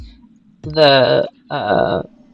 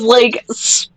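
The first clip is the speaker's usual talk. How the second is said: ordinary talk, nothing marked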